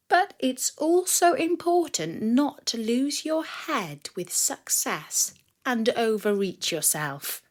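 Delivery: usual speaking voice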